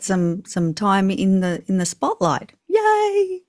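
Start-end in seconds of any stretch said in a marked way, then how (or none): 2.7-3.4 high, bright little yay of triumph; a jubilant high yay; excited cheer. Real, not acted. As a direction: cheer for finally getting the spotlight. Finally gets the spotlight, cheers.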